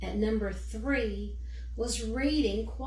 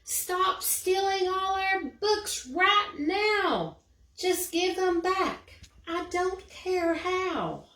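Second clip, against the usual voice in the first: high, loud, slow shout out the window; 9 semitones higher, 5 dB louder than usual. Acted. Eliza shouting from her window at the book thief. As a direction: yell it into the night. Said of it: "shouted to the thing outside".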